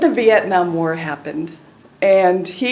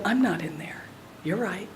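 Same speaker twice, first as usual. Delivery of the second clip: quiet inner voice; 10 dB quieter than usual. Retold or imitated. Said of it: "And a voice that said".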